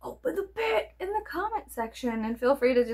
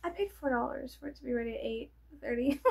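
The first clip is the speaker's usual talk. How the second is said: usual speaking voice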